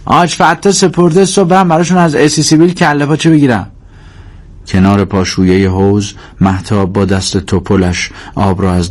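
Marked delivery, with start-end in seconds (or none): 0.0-3.7 grudging answer, high-pitched and getting faster; put-upon son's high-pitched grumble; reluctant. Acted. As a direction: explain the errand against your will. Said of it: "کریم به اکراه گفت".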